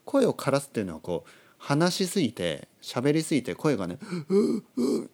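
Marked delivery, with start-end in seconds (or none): 4.3-5.1 high, strained croak; mock lost-voice groan; demonstrated hoarseness. Shown as a demonstration. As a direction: act out a voice worn out from talking. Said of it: "これは声が枯れるっていうことです".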